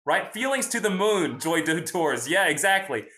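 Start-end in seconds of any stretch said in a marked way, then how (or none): none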